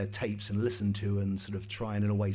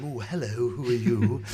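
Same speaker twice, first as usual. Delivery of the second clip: a hint of camp, drawn-out Kenny Everett hello; slow, louder mock greeting from a star; 7 dB louder than usual. In the Euston Tower corridor, Kenny Everett greets the newcomer with a lightly camp hello. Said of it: "who was going".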